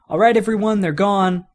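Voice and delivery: calling out. Acted. Woody calling the toys awake.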